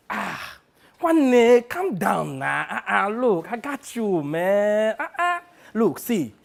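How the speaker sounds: high drawn-out exclamations from a hustling agent; Sonny, placating. Acted. Plays agent Sonny placating him.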